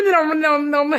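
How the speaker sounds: high, playful dog grumbling; comic pet impression; imitated dog noises. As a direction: babble like a whining dog. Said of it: "So he was going".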